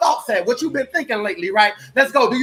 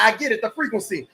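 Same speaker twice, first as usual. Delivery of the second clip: normal talking voice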